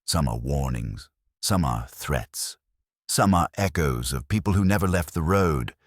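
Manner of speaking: usual speaking voice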